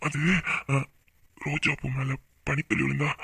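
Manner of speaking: low, soft, intimate sweet talk; tender romantic murmur. Acted. Lover praising her blushing face, tender murmur. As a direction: murmur it low and close, admiringly.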